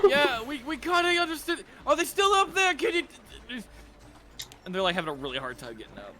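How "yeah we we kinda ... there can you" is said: high-pitched hesitant guard voice; much higher, stumbling guard; flustered guard. Acted. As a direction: answer as a confused guard looking up. GM voices guards below answering.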